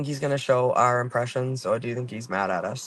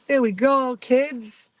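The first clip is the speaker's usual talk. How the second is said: high, louder cooing to the pets; sing-song pet voice, much higher; pet talk; 11 semitones higher, 4 dB louder, much slower than usual. Real, not acted. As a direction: lift the voice way up for the dogs. Talking to pets after parking.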